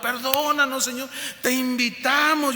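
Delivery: high-pitched imitation of a tearful plea; súplica llorosa. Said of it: "siguió llorando". (imitando una súplica llorosa, aguda)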